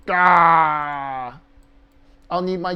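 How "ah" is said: mock wail. Real, not acted. Mock breakdown over missing print.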